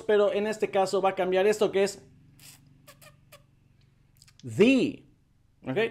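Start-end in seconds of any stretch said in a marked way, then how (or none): 4.4-5.0 "the" said as thee; "thee" vowel. Shown as a demonstration. Teacher shows article before vowels, thee. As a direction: put an ee on "the". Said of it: "ahora es con vocal I".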